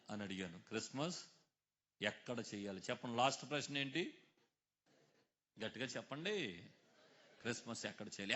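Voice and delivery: ordinary talk, nothing marked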